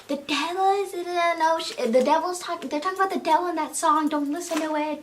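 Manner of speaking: high-pitched grandma warning; hint of a fussing grandma; scolding grandma. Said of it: "'cause she's always saying".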